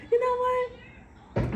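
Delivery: very high, slow, sing-song "You know what?"; high mocking drawl to the liar; mock drawn-out reply. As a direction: stretch it out high and teasing.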